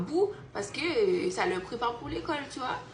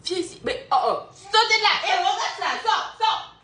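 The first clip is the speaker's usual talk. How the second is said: put-on loud, high calls to the kids; loud high mother calling out; 8 semitones higher, 6 dB louder than usual. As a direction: shout them out of there.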